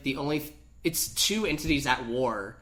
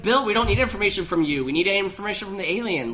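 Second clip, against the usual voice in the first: louder, higher mock exasperation; exasperated, higher and louder; mock exasperated reply; 6 semitones higher, 5 dB louder than usual. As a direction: talk down to Bill, exasperated. Mocking reply to Bill.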